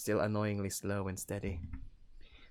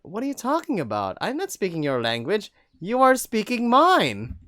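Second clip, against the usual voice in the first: angry retort; 11 semitones higher, 11 dB louder than usual. Acted. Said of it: "pissed me off".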